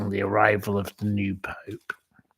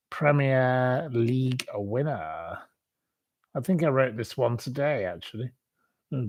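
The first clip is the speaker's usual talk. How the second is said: usual speaking voice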